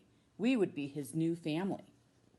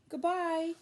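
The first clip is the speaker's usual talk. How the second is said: high, louder goodbye called out; calling goodbye; 12 semitones higher, 4 dB louder than usual. Acted. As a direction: call goodbye up into the sky. Children saying goodbye to Pluto.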